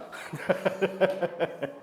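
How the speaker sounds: long laugh starting high and falling. Real, not acted. Teacher after teasing a student about academies, laughing. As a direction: laugh it out after a playful jab.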